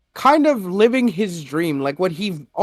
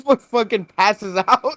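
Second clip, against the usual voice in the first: ordinary talk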